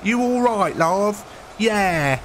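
high, drawn-out mock British accent; imitated British voice. Mimicking Americans who impersonate Brits, high mock British accent. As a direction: pitch up an American's idea of British. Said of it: "they automatically go to that voice".

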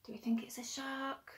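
whispered secret question. Real, not acted. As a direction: lean in and whisper it. Aside to the child listener.

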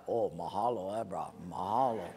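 put-on low, laid-back Hawaiian accent; Hawaiian guy. Condemned man thanking the sage, low Hawaiian accent. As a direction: give easygoing thanks in Hawaiian pidgin. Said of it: "the Hawaiian guy say".

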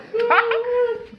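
high crying laugh; high tearful laugh. Real, not acted. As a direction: laugh till it hurts. Laughing fit.

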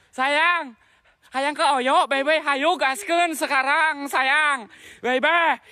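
high-pitched, drawn-out sweetheart call; teasing lover's call. Pretend. Ghost hunter calling "sayang" into the dark, high teasing call. Calls out teasingly to unseen presence.

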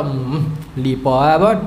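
low, slow reluctant mumble-hum; grumbling husband. Acted. Husband to wife wanting a gift, low grudging grumble. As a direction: grumble as if not interested.

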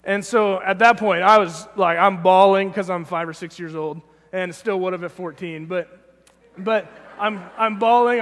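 usual speaking voice

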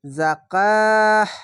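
slow chanted tilawat, vowel stretched long; Quran recitation. Shown as a demonstration. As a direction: intone the word as in Quran reading. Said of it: "খার জাবারে এক আলিফ টেনে পড়বেন".

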